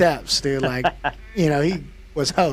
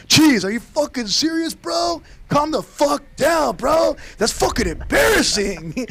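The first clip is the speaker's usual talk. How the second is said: higher, louder shout at a friend; exasperated yell; 13 semitones higher, 3 dB louder than usual. Retold or imitated. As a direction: tell your buddy off loudly. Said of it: "our boy Wishbone was like".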